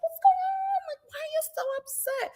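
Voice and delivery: very high, softened mock-innocent voice. [name] asking why Teresa is upset, mock innocent. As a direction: play clueless in a tiny high voice. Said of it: "Not [name] acting innocent".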